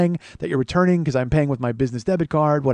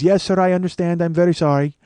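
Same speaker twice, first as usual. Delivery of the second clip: put-on Indian-accented apologetic clerk; mock Indian accent, slightly raised; 4 semitones higher, 4 dB louder than usual. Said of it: "he's got that, uh, accent".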